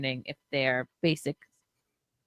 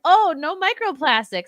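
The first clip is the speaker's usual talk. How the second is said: mocking; 9 semitones higher, 6 dB louder than usual. Mocking her own reasoning.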